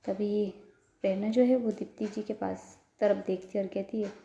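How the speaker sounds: usual speaking voice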